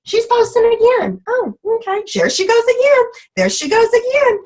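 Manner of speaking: sing-song mock of an impressed teammate; imitating the downline. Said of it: "And your downline goes".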